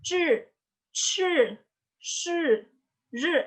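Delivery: "Zhi, shi, ri" with tongue curled to the palate; retroflex sounds. Shown as a demonstration. Teacher showing lidah ditekuk, curled-tongue syllables. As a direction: curl the tongue up for each high "shi". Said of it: "lidahnya ditekuk di langit-langit mulut".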